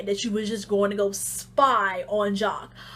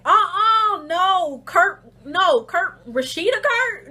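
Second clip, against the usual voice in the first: mock protest, higher and a bit louder; acted disbelief; 6 semitones higher, 4 dB louder, slower than usual. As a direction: refuse the news, voice pushed up. Acting friend's refusal to believe.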